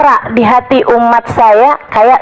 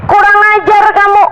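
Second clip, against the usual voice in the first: raised, higher scolding voice of an angry man; mock scolding; 10 semitones higher than usual. Man scolding the sheikh during the sermon, raised and indignant. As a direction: snap at the sheikh in a high, rebuking voice.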